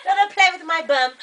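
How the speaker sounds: high, giggly imitation Australian; badly put-on Australian through laughter; mock Australian accent. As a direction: play at Australian, cracking up. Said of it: "it's Australian".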